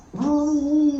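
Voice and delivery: a gleeful hollered woo, retold; mock woo. Telling a buddy about A J's car, a loud mock whoop. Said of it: "A J said".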